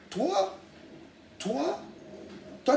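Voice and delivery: high indignant toi. Real, not acted. Outraged call-out of rival.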